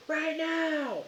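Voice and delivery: high, drawn-out yell over a helicopter. Acted. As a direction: holler it slowly at high pitch. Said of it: "and you're all yelling".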